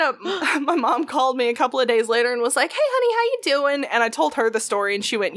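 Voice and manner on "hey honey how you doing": much higher, sweet motherly greeting; a hint of a doting mother calling; mom's sweet voice. Voicing her mom on phone.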